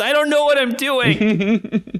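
slow, high bewildered whine; MacGruber quote. Retold or imitated. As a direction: drawl it out, totally lost. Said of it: "that scene from MacGruber".

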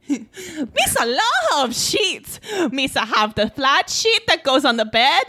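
hammed-up Jar Jar Binks voice; bad Jar Jar voice. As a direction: play a goofy alien giving boring fun facts. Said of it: "This is a bad accent".

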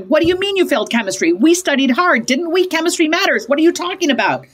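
agitated, high-pitched challenge; exasperated parent, pitch climbing as it goes; over-involved parent. Acted. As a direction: pile on indignant questions. Acted over-involved parent reacting badly.

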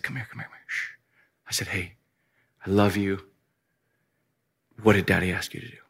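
put-on low, hushed voice close to the kids; near-whispered, tender dad talking to his boys; gentle father. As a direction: hold back boiling anger and speak very softly. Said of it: "I'm gonna be gentle".

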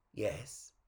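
soft, low "yes"; tentative reply. Acted. Puppet prompting the musing clown, soft and low. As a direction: answer "yes" under your breath. Puppet responds, first take.

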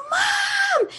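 high screamed call for mom; retold hysterical yell; screaming. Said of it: "like, screaming and crying".

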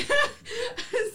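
high breathless laugh, real. Real, not acted. Cracking up while telling friends the story.

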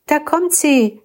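put-on high, eager cry of a boy; excited shout. A narrator's reading. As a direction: call out that she's coming. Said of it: "schrie der Ältere". (aufgeregter Ruf des älteren Jungen, höher)

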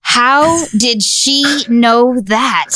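slow, drawn-out disbelief; amazed DJ. Retold or imitated. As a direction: imitate a stunned radio host, stretching each word. Retold DJ stunned by right answer.

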